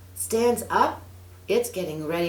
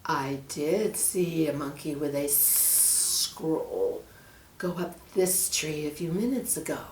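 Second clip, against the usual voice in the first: hissing snake; 4 dB quieter than usual. Acted. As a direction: draw out each word like a sly serpent. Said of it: "hissed the snake".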